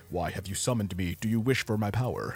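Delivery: deep demon voice. Retold or imitated. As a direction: voice a grand ominous summoned creature. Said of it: "he comes and he's like".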